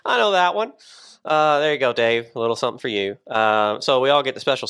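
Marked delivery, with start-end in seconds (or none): 0.0-0.7 higher, bright mock recognition. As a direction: play a guy clocking the brand name. Imitating [name] in car.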